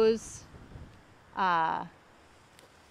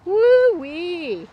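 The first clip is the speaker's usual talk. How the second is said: high, loud woo-wee; excited whoop; 8 semitones higher, 8 dB louder than usual. Real, not acted. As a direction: whoop with delight. Seeing a huge caudex.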